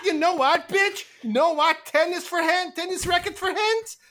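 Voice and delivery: high, loud indignant insults; slapped girl shrieking insults; outraged shriek. Acted. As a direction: scream back after being slapped. Slapped character shrieks insults back.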